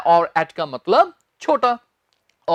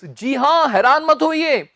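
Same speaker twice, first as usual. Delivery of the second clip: ordinary talk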